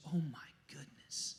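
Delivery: hushed, near-whispered amazement; marveling. Retold or imitated. Jesus at the centurion's faith, marveling under his breath. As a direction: whisper it in wonder. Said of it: "marveled at the centurion's faith".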